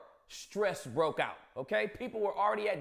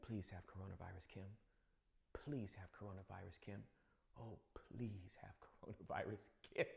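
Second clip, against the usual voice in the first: hushed, much softer plea; whispering; 11 semitones lower, 18 dB quieter than usual. Retold or imitated. Aide secretly pleading behind the leader, whispered. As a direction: breathe it under a mask. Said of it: "whispering under his mask".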